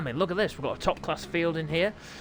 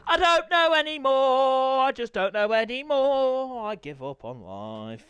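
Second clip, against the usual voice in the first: mock despair; 7 semitones higher, 3 dB louder, slower than usual. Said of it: "That's a negative attitude".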